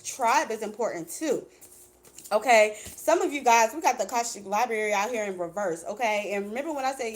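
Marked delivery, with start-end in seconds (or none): none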